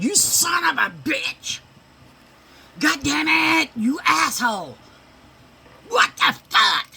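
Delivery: high screaming cusses at a game; shrieking grandma cursing at the screen; mimicked furious cussing. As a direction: scream it like an angry grandma losing.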